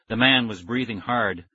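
ordinary delivery, nothing marked

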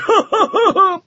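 booming roar of laughter. Acted. The champion mocks the small knight with a roar of laughter. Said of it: "roar of laughter".

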